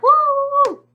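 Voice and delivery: loud high "woo"; cheering whoop. Retold or imitated. Fan as the singer walks off stage, loud whooping.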